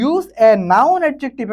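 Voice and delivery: very slow, spelled-out reading of the instruction; slow dictation. Shown as a demonstration. Teacher reading the exercise instruction to students, very slow. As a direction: read the task aloud word by word. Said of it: "teacher reads the instruction".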